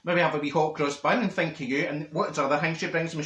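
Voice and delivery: ordinary talk, nothing marked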